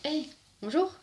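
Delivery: high, rising hello to a stranger; acted hello, pitch leaping up; acted greeting. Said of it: "ça fait genre".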